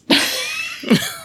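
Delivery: high-pitched burst of laughter. Real, not acted. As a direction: let out a high squeal of laughter.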